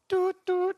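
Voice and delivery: high doot doot beep; call end beep. Pretend. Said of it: "Just go, "Doo, doo, doo".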